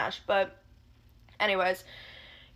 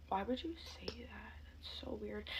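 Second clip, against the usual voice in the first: hushed, unvoiced disbelief; whispered aside; 16 dB quieter than usual. Real, not acted. Hushed aside on a creepy remark.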